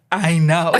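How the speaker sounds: Francis voice. Retold or imitated. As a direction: toss off I know in a lightly put-on voice. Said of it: "In my Francis voice".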